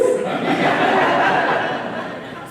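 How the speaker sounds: airy amused laugh; breathy laugh. Real, not acted. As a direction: let out a silent wheeze of a laugh. Laugh after shocked reply.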